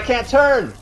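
loud panicked shout; frantic yell. Real, not acted. Frantic in boss fight.

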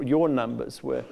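ordinary delivery

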